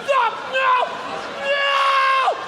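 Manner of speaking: high frantic screams of no; panicked screaming. Acted. Young pilot screaming as passenger jumps out, high panic. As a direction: beg the passenger to stop, panicking.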